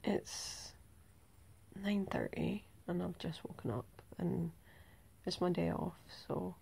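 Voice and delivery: hushed morning whisper. Real, not acted. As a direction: whisper so as not to wake anyone. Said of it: "if I'm whispering".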